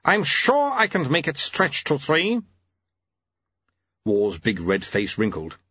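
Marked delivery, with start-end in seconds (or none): 0.0-2.4 high-pitched long-suffering wife's voice; put-upon wife. A narrator's reading. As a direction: agree as if you will make him pay later. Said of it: "voice of one who has been put upon".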